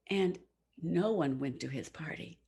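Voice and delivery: plain reading voice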